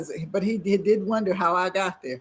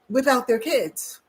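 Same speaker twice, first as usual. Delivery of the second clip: usual speaking voice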